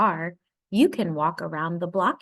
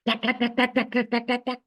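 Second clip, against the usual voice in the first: higher, hammering tack tack; jackhammer sound; 5 semitones higher, faster than usual. A narrator's reading. Reading to a child, imitating the street jackhammer. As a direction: rattle it out like a jackhammer. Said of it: "It makes a loud banging sound".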